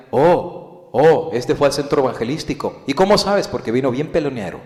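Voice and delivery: loud, high mocking brethren; mocking church gossip. Church members mocking a returnee, loud and high. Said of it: "Que los hermanos en tus iglesias no digan".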